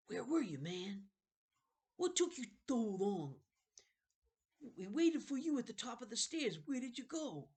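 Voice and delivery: put-on high, loud, eager boy voices; eager kid friends. Friends in the kitchen questioning Jonathan, eager high kid voices. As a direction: pepper him with worried questions. Friends pepper him anxiously.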